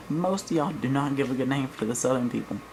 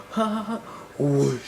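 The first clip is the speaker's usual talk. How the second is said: goofy dad. Retold or imitated. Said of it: "He's like".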